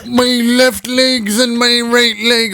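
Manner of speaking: high, slow, dazed mock zombie voice; zombie partygoer. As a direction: drag it out like a spaced-out zombie. Mocking zombie-mode partygoers.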